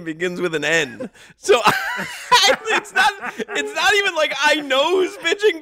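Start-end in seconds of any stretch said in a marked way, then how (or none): 1.4-5.6 high, loud words bursting through laughter; laughing hard. Real, not acted. Host explaining why the pitcher's name is wrong, laughing hard. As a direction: try to explain while cracking up.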